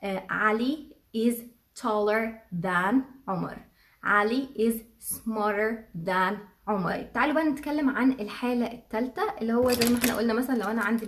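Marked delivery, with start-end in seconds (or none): 0.3-3.6 slow model sentence. Shown as a demonstration. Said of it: "يعني بنقول". 4.0-7.0 slow model sentence. Shown as a demonstration. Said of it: "يعني بنقول".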